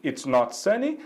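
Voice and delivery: usual speaking voice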